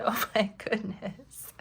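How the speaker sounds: slow whispered laugh through the words. Retold or imitated. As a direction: whisper it, giggling. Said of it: "So I said".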